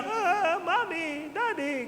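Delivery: put-on high wailing child's cry for mommy and daddy; mock a small child waking up crying from a bad dream; child crying after a nightmare, imitated; imitating a crying child.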